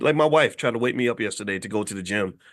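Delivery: ordinary delivery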